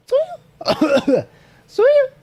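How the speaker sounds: squeaky high-pitched farewell; cute high goodbye. Acted. Cute farewell to stream viewers.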